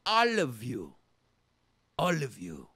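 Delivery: ALL stressed, low and falling; a Greek father's all-consuming love; mock Greek devotion. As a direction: lean hard on ALL both times. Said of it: "he says".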